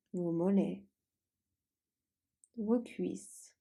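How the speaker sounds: calm Yoga Nidra guidance, unhurried, long pauses; guided meditation. Acted. Leads a guided relaxation.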